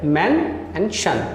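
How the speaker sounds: मैन and शन said slowly as two separate parts; slow, separate syllables of mention; split into syllables. Shown as a demonstration. Teacher breaking a word into two syllables, slow and separate. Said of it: "टू syllable word में तोड़ दिया".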